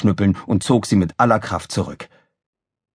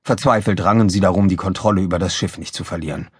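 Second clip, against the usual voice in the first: ordinary delivery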